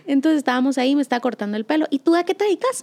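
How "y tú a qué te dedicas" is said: hairdresser asking. Retold or imitated. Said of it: "me dijo".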